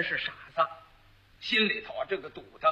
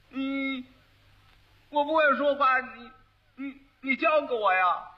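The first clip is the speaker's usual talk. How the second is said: long hum, then higher halting speech; stammering fool; 4 semitones higher than usual. Acted. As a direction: simpleton stumbling into a request. Fool asks wife to teach him.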